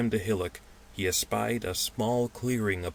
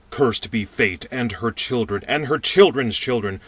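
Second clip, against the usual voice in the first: loud, much higher cursing cry; 5 semitones higher, 9 dB louder than usual. Acted. Little man cursing fate at the lost men, loud and higher. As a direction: rail against fate in despair. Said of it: "he cried".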